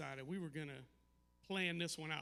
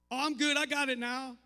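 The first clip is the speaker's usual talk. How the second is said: loud, high breezy daughter voice; imitating a daughter who's suddenly fine; mock daughter brush-off; 6 semitones higher, 9 dB louder than usual. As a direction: brush dad off loudly, all fine now. Daughter brushing him off on phone.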